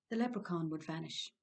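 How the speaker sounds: plain reading voice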